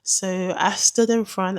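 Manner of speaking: ordinary delivery, nothing marked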